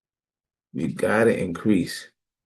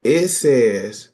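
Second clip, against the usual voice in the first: ordinary talk, nothing marked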